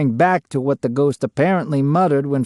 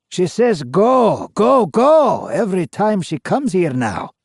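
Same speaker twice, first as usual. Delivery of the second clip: the ghost's voice rising in protest; Scottish ghost voice; 6 semitones higher than usual. A narrator's reading. Ghost answering the interviewer in a higher Scottish voice. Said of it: "answers the voice".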